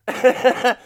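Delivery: sudden high burst of laughter; real laugh, high and loud. Real, not acted. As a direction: crack up loudly. Big laugh while recapping.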